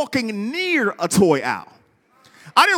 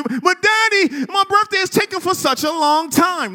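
put-on whiny child; 7 semitones higher than usual. Son begging his daddy for the T-Rex now, whiny. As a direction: whine it like an impatient little boy. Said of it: "child's reply".

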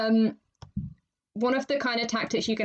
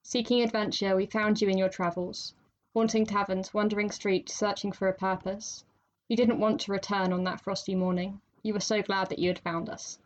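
poem reading; 3 semitones lower, 3 dB quieter than usual. Acted.

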